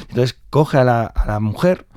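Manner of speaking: ordinary delivery, nothing marked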